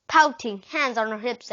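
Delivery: ordinary delivery, nothing marked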